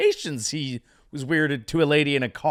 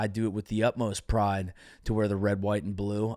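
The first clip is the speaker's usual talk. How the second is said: lower, softer, earnest press answer; sincere athlete statement; 8 semitones lower, 6 dB quieter than usual. Acted. Host voices the model athlete's answer, soft and sincere. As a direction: speak it like a humble, proud athlete. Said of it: "you basically say, like".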